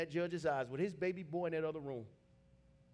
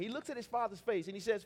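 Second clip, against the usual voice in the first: ordinary talk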